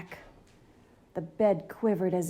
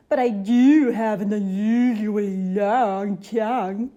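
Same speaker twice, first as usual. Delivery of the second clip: louder monster voice, showing off; proud monster boast; 8 dB louder than usual. Acted. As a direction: brag about the tongue like a goofy monster. Mac boasts of tongue.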